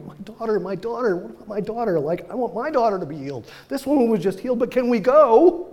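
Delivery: high, loud, desperate pleading; frantic father. Retold or imitated. Father out of his mind, frantically begging Jesus to hurry. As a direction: beg like he's losing his mind. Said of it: "probably out of his mind".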